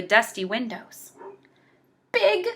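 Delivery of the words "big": ordinary delivery, nothing marked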